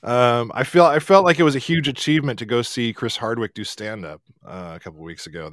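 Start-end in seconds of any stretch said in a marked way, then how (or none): none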